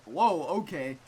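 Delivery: loud startled whoa, okay. Real, not acted. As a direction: caught off guard by the dark turn. Reacts to shocking line.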